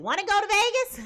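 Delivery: bright, loud mock invite; excited invitation. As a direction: toss it out like a thrilling offer. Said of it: "Butterfly said".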